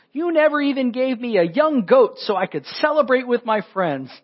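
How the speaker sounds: put-on older brother whining to his father like a kid; whiny kid. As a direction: whine it like a sulking kid. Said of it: "he kinda turns into a whiny kid".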